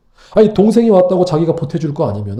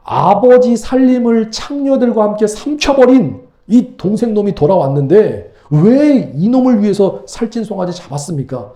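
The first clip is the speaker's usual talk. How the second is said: venomous accusation; 4 semitones higher than usual. Acted. Older son spits contempt at returned brother, bitter high accusation. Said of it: "따지고 있는 모습이 입에 지금 독한 게 있어요".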